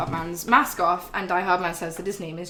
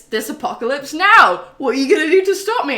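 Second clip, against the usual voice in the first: higher, louder, quick mocking challenge; taunting villain; 7 semitones higher, 9 dB louder than usual. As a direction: goad the hero, fast and loud. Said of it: "And Higgs is like".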